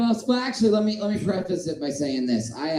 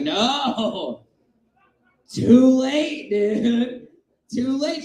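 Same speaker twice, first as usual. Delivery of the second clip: mock protest; 7 semitones higher, 4 dB louder, much slower than usual. Acts out protest to haircutter.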